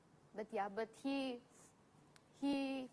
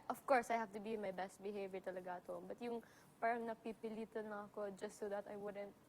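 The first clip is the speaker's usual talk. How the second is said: ordinary talk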